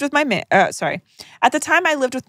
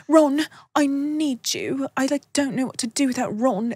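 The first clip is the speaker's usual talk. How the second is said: posh Harry; 4 semitones higher, 4 dB quieter than usual. Pretend. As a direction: whine for Ron like a helpless posh boy. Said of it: "'cause, like".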